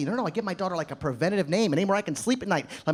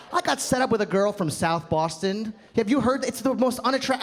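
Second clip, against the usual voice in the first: ordinary talk, nothing marked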